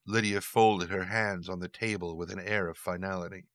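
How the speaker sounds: ordinary delivery, nothing marked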